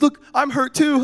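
high, loud singsong; mock cheeriness. Cousins distracting hurt boy, high singsong coaxing. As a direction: coax a crying child with fake hurt.

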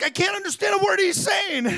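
mock shout. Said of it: "he's like".

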